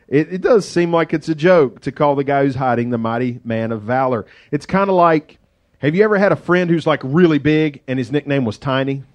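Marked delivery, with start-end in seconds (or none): none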